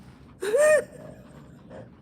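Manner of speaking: high-pitched burst of mock crying; hammed-up blubbering; fake sobbing. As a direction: wail wordlessly, then shudder. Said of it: "crying like that".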